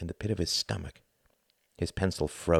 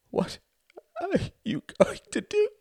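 high, broken question with gulping pauses; gulping, voice catching. A narrator's reading. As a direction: catch the voice heartbreakingly on the last word. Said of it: "her voice catching in a heartbreaking manner on the last word".

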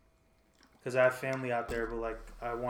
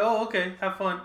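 higher, breezy mock parents; 7 semitones higher, 6 dB louder than usual. Friend imagining his parents' reply, mock breezy voice. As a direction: play the easygoing parents.

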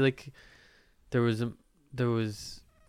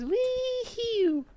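excited whoop. Real, not acted. Cheer after welcoming guests.

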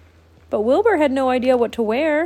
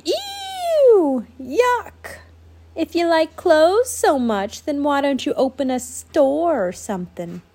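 high, mocking sneer; sarcastic sneer; 7 semitones higher than usual. Mole rats sneering sarcastically.

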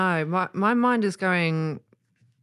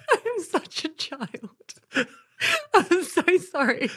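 high, giggling squeal through the words; really cracking up, barely getting words out; helpless laughter; 13 semitones higher than usual. Real, not acted. Apologising for childish giggles at a name, helpless laughter.

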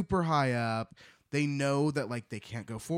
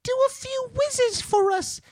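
very high, squeaky little piglet; 5 dB louder than usual. Acted. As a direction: squeal it up high like a tiny pig. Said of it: "Do the piglet voice".

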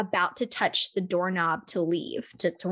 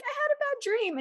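put-on very high little-kid voice; childish whine. Mock childish complaint.

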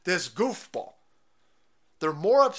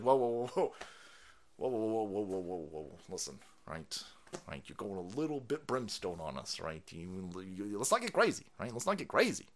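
low, soft, backing-off imitation; 10 semitones lower, 10 dB quieter than usual. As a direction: play someone softly trying to calm things down.

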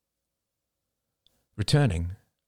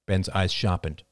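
usual speaking voice

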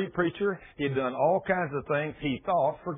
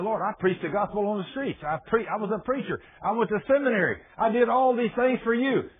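high-pitched, mounting self-defence; acted indignant protest, building to the end; pleading protest; 7 semitones higher, 3 dB louder than usual. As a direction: plead your case, climbing higher and louder. Preacher protests to God his record.

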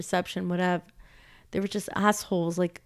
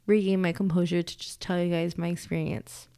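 usual speaking voice

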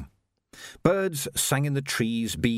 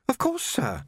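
put-on much higher, polite young Jocasta, a touch breathless; young woman voiced. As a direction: play a well-mannered girl treading water. Jocasta hurt.

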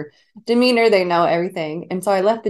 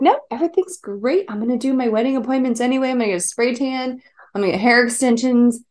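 higher, relentlessly chipper and bright-sided; Sister Mary Sunshine; 5 semitones higher than usual. Retold or imitated. Bride reassuring worried friends, forced sunny cheer. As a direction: sing out forced cheer like nothing is wrong. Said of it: "Sister Mary Sunshine mode".

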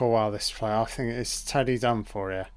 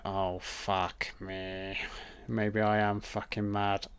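Teddy's despairing thought; 3 semitones lower, 7 dB quieter, slower than usual. Retold or imitated. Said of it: "but now he's thinking".

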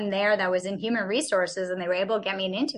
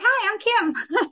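laughing hello; 13 semitones higher, 4 dB louder, slower than usual. Retold or imitated. Retold cheerful intro with laugh.

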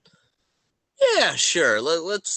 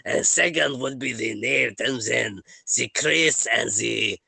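put-on French accent; 3 semitones lower than usual. Game master guesses the order of players, halting French accent. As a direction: list names haltingly in a mock French accent. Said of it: "am I to continue with it".

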